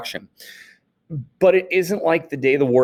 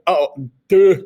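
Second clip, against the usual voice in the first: a touch of a dopey voice, higher and louder; dopey self; 7 semitones higher, 8 dB louder than usual. Retold or imitated. Teacher voicing his younger self answering the clerk, dopey. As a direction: play yourself as a clueless tourist.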